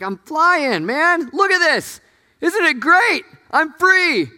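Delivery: very high, loud, gleeful cries; giddy high-pitched show of freedom; exhilarated kite. Acted. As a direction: shout it out, thrilled to be flying. Acts out the gleeful flying kite.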